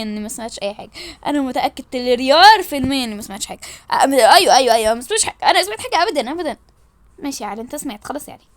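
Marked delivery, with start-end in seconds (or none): none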